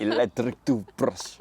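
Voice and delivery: slow, mock Russian accent; imitating Russian accent. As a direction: tease her Russian accent. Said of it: "What is this Russian accent?".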